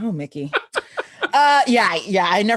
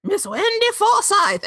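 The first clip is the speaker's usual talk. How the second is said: put-on high, drawn-out old man announcement; old man announcing; 12 semitones higher than usual. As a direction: stretch out the name high like an old herald. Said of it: "Old man comes back in and says".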